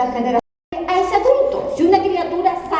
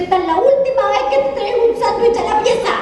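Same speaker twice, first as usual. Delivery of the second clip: higher, mock stern mom; mother's voice; 6 semitones higher than usual. Mom warns daughter in her room, higher mock stern voice. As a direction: play the fed-up mom, lightly. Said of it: "llega a la pieza y me dice".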